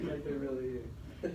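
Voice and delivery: slow, laughing through the words; half-laughing at the memory; amused, incredulous chuckle; laughing disbelief. Retold or imitated. Stunned in-laws visit, laughing.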